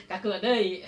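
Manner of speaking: loud, said through laughter; laughing. Real, not acted. Teacher joking with students about stiff reading, laughing. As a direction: let the laugh spill into the words.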